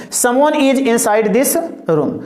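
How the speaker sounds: each word spaced out slowly; slow teacher dictation. Shown as a demonstration. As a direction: dictate the example sentence slowly for students to copy. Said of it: "reads example sentence slowly".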